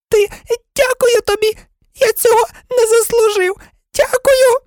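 very high, squeaky gratitude; grovelling thanks. Acted. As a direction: gush thanks in a tearful tiny voice. (тоненька запобіглива вдячність)

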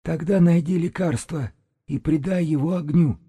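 high-pitched whistling through the throat; eerie shaman piping his orders high; high, thin throat-whistle voice; whistling throaty shaman. A narrator's reading. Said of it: "тихонько просвистел горлом шаман".

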